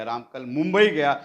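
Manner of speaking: मुंबई पर ज़ोर, आवाज़ थोड़ी तेज़; stress on Mumbai. Shown as a demonstration. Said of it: "शब्दों पर जोर देकर बोलना". ("मुंबई" शब्द पर ज़ोर देकर)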